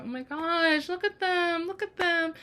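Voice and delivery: high-pitched cooing; tender gushing. Retold or imitated. Host watches father and son, tender gushing.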